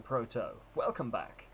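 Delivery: ordinary delivery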